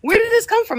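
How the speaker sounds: shocked disbelief. Retold or imitated. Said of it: "I'm looking up like".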